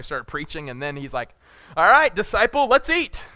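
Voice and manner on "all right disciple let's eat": cheery Jesus. Retold or imitated. Jesus voiced in retold story.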